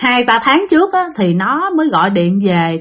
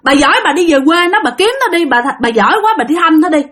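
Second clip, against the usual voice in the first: higher-pitched mock hollering, little extra volume; irritated scolding, pitched up; retold yelling outburst; 6 semitones higher, faster than usual. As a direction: imitate someone kicking up a noisy fuss. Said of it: "nó mới la làng lên".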